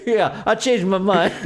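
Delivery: slow words through real laughter; laughing. Real, not acted. Joking with a friend, laughing through the words. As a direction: laugh while you say it.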